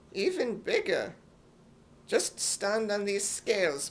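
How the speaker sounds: usual speaking voice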